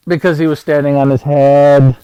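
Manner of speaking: loud punchline. Acted. Uncle delivering the silly riddle's answer, loud shout.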